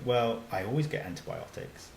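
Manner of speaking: ordinary delivery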